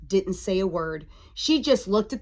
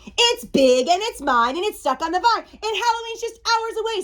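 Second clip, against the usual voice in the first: high, loud complaint climbing higher; frantic witch, pitch rising; exasperated witch; 10 semitones higher, 3 dB louder than usual. A narrator's reading. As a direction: wail in storybook exasperation.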